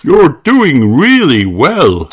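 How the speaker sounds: loud, slow cheering shout; drawn-out encouraging holler. Acted. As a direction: call out proud encouragement from behind. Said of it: "shouts Daddy Pig".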